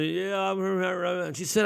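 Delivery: mumbled, slurred half-asleep reply; sleep-talking. Retold or imitated. Half-asleep kid answering mom at wake-up, mumbled sleep-talk. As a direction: mumble as if still fast asleep. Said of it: "they're really still asleep".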